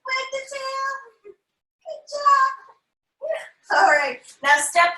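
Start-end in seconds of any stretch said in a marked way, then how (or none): 0.0-2.5 put-on high, stretched-out coaxing for kids; playful high voice. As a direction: coax them in a high little voice. Playful cue as excited dog.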